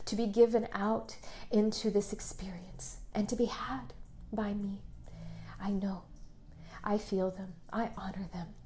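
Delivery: hushed meditative prayer voice; guided meditation. Acted. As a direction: breathe the words to them gently. Leads a guided affirmation meditation.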